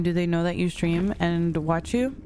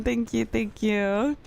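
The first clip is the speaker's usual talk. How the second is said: ordinary delivery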